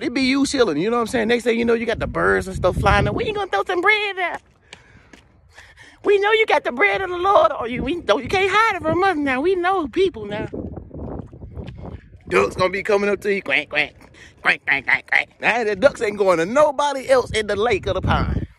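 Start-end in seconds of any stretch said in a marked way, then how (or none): 3.1-10.5 squeaky high birds begging for bread. Acted. Birds voiced asking for bread. 13.4-15.3 low duck quacks. Acted. Ducks imitated.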